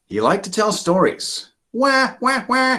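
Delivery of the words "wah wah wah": high mock baby wail; little sister voiced as a high mock baby wail; baby crying. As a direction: fake a little sister's whiny cry. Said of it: "goes the little sister".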